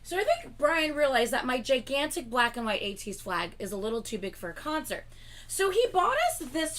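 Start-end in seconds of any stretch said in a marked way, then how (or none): none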